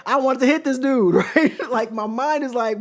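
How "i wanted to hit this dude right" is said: laughing. Real, not acted. Laughing at his own urge.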